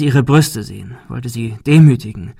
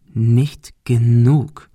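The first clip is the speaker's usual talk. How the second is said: slow threat; much slower than usual. Acted. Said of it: "wiederholte Mike".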